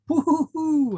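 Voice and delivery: high loud woo-hoo of glee; excited whoop. Real, not acted. Host cheers about yoga before the convention, high and loud. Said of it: "I can't wait".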